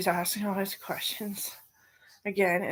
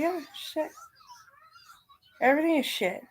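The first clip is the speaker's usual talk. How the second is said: higher, exaggerated fed-up exclamation; mock exasperation; 10 semitones higher than usual. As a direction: play up the frustration, half joking. Mom's retold exasperated reply.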